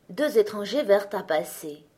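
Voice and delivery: ordinary delivery, nothing marked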